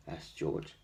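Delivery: usual speaking voice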